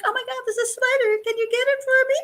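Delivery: very high-pitched mock girlish plea; helpless, childlike. Tenant asking her landlady to get a spider, mock helpless little voice. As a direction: play helpless, begging someone to rescue you. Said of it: "she wasn't a tough girl".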